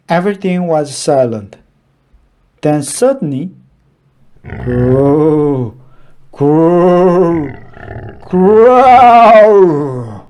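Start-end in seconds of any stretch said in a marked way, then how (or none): 4.4-10.3 drawn-out growling, building louder; spooky growl. A narrator's reading. Narrator voicing the scary forest growl, menacing. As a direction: rumble it like a hidden beast. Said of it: "scariest sound".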